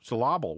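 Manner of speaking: "Syllable" said as suh-LAH-bul; misplaced accent. Shown as a demonstration. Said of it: "with the accent in the wrong place".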